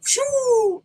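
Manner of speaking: high drawn-out whoosh; long mock take-off noise; flying-away whoosh. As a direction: imitate a hero zooming off. Imitates the hero flying away.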